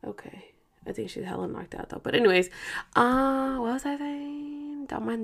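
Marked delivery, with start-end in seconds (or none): 0.1-2.0 soft unvoiced whisper. Real, not acted. Checking the sleeping niece, hushed whisper. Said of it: "she's about to start crying".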